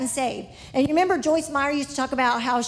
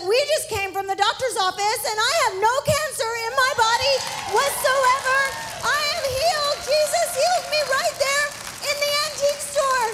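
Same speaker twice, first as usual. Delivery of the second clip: rising higher and faster with joy; overjoyed; 11 semitones higher, faster than usual. Retold or imitated. Healed lady telling the preacher's friend, joyful and excited. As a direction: burst out with the good news, pitch climbing.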